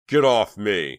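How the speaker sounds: loud furious yell; raging. A narrator's reading. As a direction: rage at the guard. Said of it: "Chuck raged".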